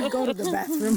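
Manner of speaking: hammed-up get-out-of-here; mock dismissive. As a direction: shoo them away in jest.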